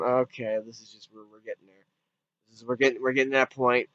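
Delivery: low, soft, resigned aside; resigned reaction. Retold or imitated. Viewer reacting to a piled-up plot, quietly resigned. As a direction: drop away quietly as if giving up.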